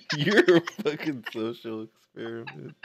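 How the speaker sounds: high, loud retort through laughter; laughing comeback. Real, not acted. Co-streamer answers the social experiment joke, laughing. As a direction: throw it back while cracking up. Jokey comeback after being teased.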